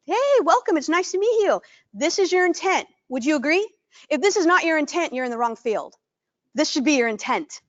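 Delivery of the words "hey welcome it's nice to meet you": cheerful welcome. Shown as a demonstration. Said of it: "you're like".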